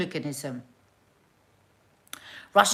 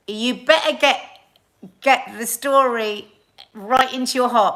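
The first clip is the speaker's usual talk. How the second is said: emphatic urging; 5 semitones higher, 8 dB louder than usual. Real, not acted. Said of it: "urging the audience".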